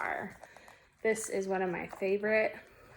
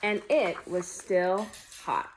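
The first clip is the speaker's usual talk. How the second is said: slow story ending; slower than usual. A narrator's reading. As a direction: wind the tale down gently, spacing out the words.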